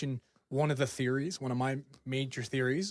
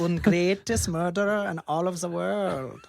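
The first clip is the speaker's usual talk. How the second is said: mock German boast; 5 semitones higher, 5 dB louder, slower than usual. Keeps the German killer bit.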